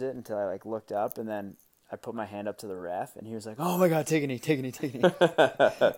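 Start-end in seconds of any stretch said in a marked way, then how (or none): 3.6-5.9 high, loud urgent yelling; alarmed ref shouting. Retold or imitated. Ref yelling at the hurt player to kneel, loud and alarmed. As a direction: bark the order at the injured player in a panic. Ref reacting to broken finger.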